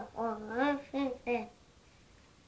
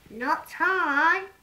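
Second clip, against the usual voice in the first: shouted refusal; 5 semitones higher, 9 dB louder than usual. Acted. The cat refuses loudly.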